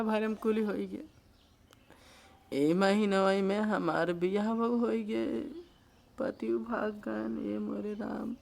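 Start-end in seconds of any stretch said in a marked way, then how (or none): 2.5-8.3 real tearful lament, dragging out the words; crying. Real, not acted. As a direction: sob it out, sinking into despair. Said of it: "एक रोइए मत". (रोते हुए विलाप)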